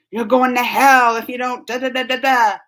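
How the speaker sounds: loud mock fire-and-brimstone warning; hammed-up damnation threat; mock threatening. Mimics threatening Christians in a retold warning.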